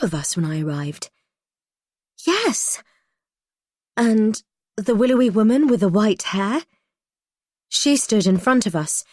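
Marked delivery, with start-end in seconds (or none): 2.3-6.6 excited. A narrator's reading. Sudden memory breaks through.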